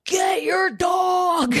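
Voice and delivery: drawn-out high yell, half crying; screaming at top of lungs. Retold or imitated. Retelling a boxer charge, she screams at the owner. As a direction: scream it slow and desperate, stretching every word. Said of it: "I was screaming at the top of my lungs".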